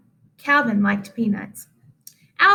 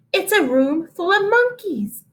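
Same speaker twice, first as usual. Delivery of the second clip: high, bright teacherly exclamation; a prim teacher voice, pitched up; teacher's voice; 8 semitones higher than usual. Acted. Teacher thinks class is monkeys.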